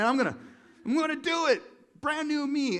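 high, loud pumped-up vow; mock inspired. Preacher imitating people inspired around January, mock excitement. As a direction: declare it with hammed-up zeal. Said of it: "we get inspired and we're like".

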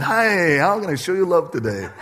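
laughing, mock-sappy question to the foreman. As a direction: ham it up syrupy sweet. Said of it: "See you doing it sappy".

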